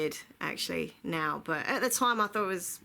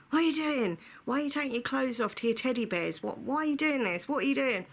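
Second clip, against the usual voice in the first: higher-pitched, exasperated questioning; mock annoyed dad; 6 semitones higher than usual. Dad catching his daughter stripping for teddies, mock outrage. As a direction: imitate an appalled parent demanding answers. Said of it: "my dad went absolutely crazy at me. He was so annoyed".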